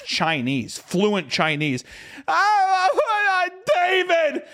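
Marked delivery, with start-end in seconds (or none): 2.3-4.4 high, loud anguished scream; wail, then shriek the name for a lost child; yelling for child. Acted. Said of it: "I was yelling out for David".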